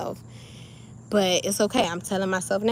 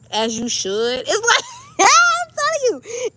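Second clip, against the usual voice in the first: much higher laughing insistence; real laughter while insisting; 12 semitones higher, 5 dB louder than usual. Real, not acted. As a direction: laugh right through the words.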